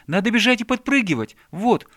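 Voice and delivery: high, loud, eager instruction; excited shouting. A narrator's reading. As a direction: shout out a new game idea. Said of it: "крикнул ёжик".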